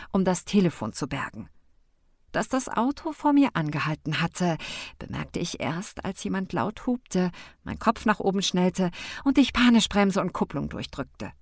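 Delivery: plain reading voice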